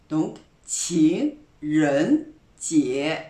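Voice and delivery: 情人节 said very slowly, each syllable clear, all three second tones rising; slow model pronunciation. Shown as a demonstration. Teacher modelling a word for French learners, slow and careful. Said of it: "On reprend".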